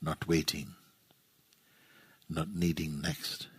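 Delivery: low, soft phrases, each left to settle; guided meditation. Real, not acted. Teacher guides listeners into resting in their own presence, guided meditation. Leads a silent guided contemplation.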